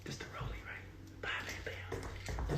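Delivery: hushed secret whisper. Shown as a demonstration. Speaker hushing the crowd on the key word, whispered.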